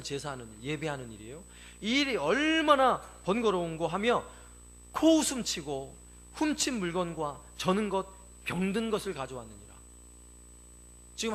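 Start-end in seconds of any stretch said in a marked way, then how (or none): 1.8-9.7 slow, loud preacher reading; ringing, stretched Bible reading; scripture declamation. Acted. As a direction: proclaim the verse with drawn-out emphasis.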